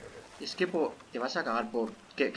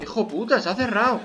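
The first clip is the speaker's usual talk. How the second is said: raised, high-pitched swearing at the game; exasperated, higher-voiced outburst; frustrated cursing; 7 semitones higher, 5 dB louder than usual. Real, not acted. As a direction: curse it out, pitched up. Curses at a door closing.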